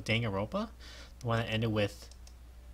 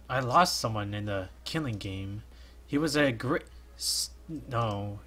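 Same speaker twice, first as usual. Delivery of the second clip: ordinary talk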